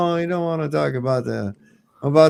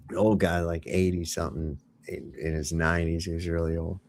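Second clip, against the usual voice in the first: low soft joke voice; 9 semitones lower, 9 dB quieter than usual. Acted. Telling joke about old guy.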